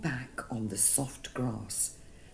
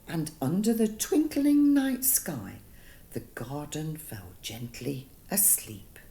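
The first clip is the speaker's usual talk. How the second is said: ordinary delivery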